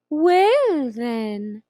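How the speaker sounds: slow, purring Cheshire Cat voice; slow drawling cat. Acted. Grinning cat teasing the lost girl, slow and drawn out. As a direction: stretch each word like a lazy cat.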